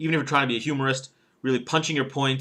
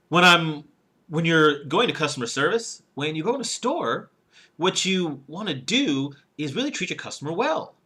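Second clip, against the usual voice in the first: higher pitch, lifting each phrase end; rising phrase endings; 4 semitones higher than usual. Shown as a demonstration. Speech coach showing bad tone on customer service, rising endings. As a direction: demonstrate uptalk, every phrase going up. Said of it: "see how each one kinda goes up there".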